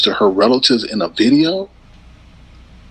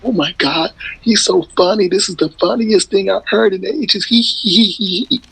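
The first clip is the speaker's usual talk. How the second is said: imitating laughter; 9 semitones higher than usual. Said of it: "what she was laughing at".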